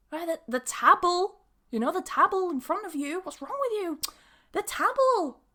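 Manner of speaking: high-pitched, insistent, "table" said as "tabble"; mock exasperation. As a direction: act fed up at not being understood, pitch climbing. Acted exasperated speaker repeating herself.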